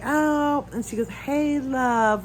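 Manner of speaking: lightly voiced sugary "Hey, love"; a hint of sweet-talking coordinator. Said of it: "which drives me crazy".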